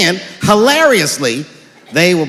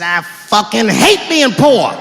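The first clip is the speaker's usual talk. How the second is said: said for dad to hear; 3 semitones higher, much slower than usual. Retold or imitated. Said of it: "so he could hear it".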